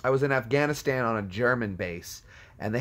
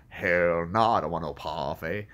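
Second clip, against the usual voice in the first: emphatic comic refusal; 7 semitones lower than usual. Acted. Imagined person refusing parfait, low emphatic character voice. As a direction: drop low and draw out the refusal. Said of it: "They'd be like".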